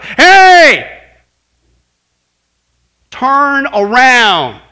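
huge, high drawn-out shout. Acted. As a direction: bellow a warning across a distance. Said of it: "Shouts in our pain".

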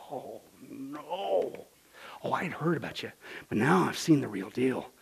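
low, soft acted moan; moaning in despair. Preacher imagines Job seeing God, moaning in despair. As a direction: moan it, hushed and crushed. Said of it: "did Job literally moan in despair?".

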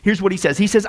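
ordinary talk, nothing marked

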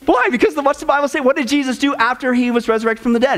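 ordinary delivery

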